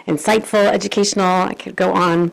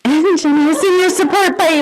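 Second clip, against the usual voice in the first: laughing; 9 semitones higher than usual. Real, not acted. Asking for support while laughing.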